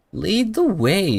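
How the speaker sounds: high, slow, sing-song imitation of a small girl; mimicking the character. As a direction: copy the game character's cute line, slow and high. Echoing the game character's line.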